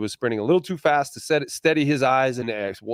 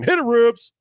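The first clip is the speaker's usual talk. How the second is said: loud, raised call to the producer; calling out a cue; 10 semitones higher, 5 dB louder, slower than usual. Real, not acted. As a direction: sing out the go-ahead, high and loud. Calls the cue to roll the play.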